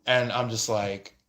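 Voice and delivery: normal talking voice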